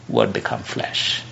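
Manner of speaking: a whispered echo of the phrase; awed whisper. Real, not acted. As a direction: breathe it out low and hushed. Preacher repeats key phrase softly.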